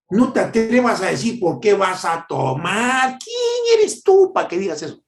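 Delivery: mock outrage with pitch leaping up on the protests; hammed-up righteous indignation; indignant scolding. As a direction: scold like an offended, self-righteous believer. Acts a scolding, indignant churchgoer.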